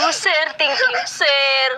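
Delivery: half laughing, half crying thank you. Real, not acted. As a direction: thank him while tears and laughter mix. Grandmother crying, thanking the donor. (umiiyak at tumatawa)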